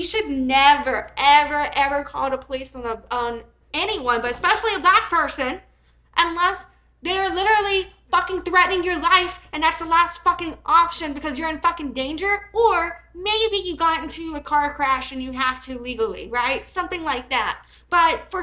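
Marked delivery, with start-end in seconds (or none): none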